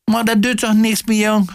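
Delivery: high-pitched consoling mother; mother's high, comforting call. A narrator's reading. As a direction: reassure your son in a high cry. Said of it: "riep de moeke".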